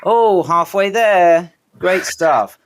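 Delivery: high, exaggerated fake enthusiasm; drawn-out, patronising congratulations; sarcastic praise. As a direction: pretend to be hugely impressed. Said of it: "if they're really sarcastic".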